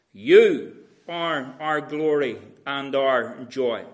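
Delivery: "You" leaned on hard at the start, the rest slow; stress on you. Shown as a demonstration. Preacher stressing "You" in Paul's verse, slow and weighty. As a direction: hit "You" and let the line unroll slowly. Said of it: "The emphasis on the word you".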